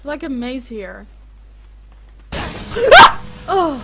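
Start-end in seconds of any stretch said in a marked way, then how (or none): 1.1-3.4 high, loud shriek of fright; panicked shriek. Real, not acted. Gamer surprised by the monster, high panicked shriek.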